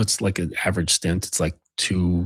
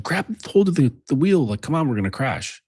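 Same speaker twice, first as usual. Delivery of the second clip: higher-pitched imitation of a panicking passenger; mock freaking out; 6 semitones higher than usual. Passenger begs driver to take the wheel, freaking out. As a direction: plead urgently, acting out the panic. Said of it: "he's freaking out".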